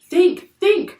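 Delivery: very high, loud prodding; urgent urging. A narrator's reading. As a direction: press him to remember. Annie urges Jack to remember.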